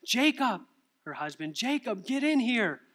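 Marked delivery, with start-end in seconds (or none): 0.0-0.6 high, louder call for her husband; excited yell. Acted. Rachel yells from the bathroom floor for her husband, excited yell. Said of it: "She yells". 1.5-2.8 high-pitched, urgent summons; excited yell. Acted. Rachel yells for her husband to see the test, excited yell. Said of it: "She yells".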